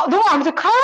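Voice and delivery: loud, lifted instant yes; imitated eagerness. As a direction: jump at the invitation as the friend did. Acting out friend's eager acceptance.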